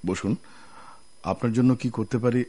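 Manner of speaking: ordinary talk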